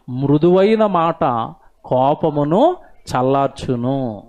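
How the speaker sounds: slow, solemn reading of a Bible verse; scripture recitation. Acted. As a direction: intone the verse slowly and gravely. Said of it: "బైబిల్లో ఉంటది ఇది". (నెమ్మదిగా గంభీరంగా వచన పఠనం)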